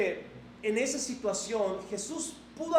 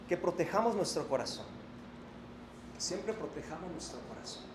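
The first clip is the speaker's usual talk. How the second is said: softer, lower, gentle preacher's plea; soft pastoral appeal; 7 semitones lower, 6 dB quieter than usual. Acted. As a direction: ease the congregation down with quiet sincerity.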